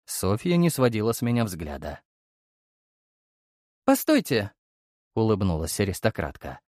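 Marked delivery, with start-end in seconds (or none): 3.8-4.5 оклик. Acted. Аристократка окликает героя.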